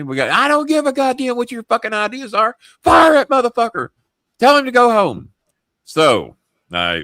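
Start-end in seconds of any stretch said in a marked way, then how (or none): 0.3-5.3 high, loud ranting boss impression; furious. Boss ordering him to fire Mark, furious. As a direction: rage down the phone, building to a loud order. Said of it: "they're fucking furious".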